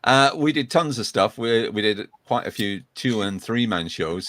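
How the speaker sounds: normal talking voice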